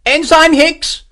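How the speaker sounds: high, loud searching shout; calling out. A narrator's reading. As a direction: call for your ensign across the chaos. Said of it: "Morris called".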